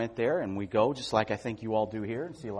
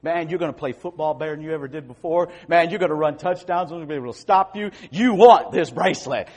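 high, loud salesman pitch; slick used car salesman; 4 semitones higher, 7 dB louder than usual. Acted. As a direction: sell it hard and fast. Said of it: "I sound like a used car salesman".

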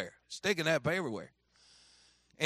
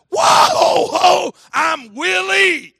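very high, huge whooping shout, drawn out; exuberant yell; 15 dB louder, much slower than usual. Retold or imitated. Willie jumps onto the bus steps, whooping exuberantly. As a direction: burst in like a wildly excited kid.

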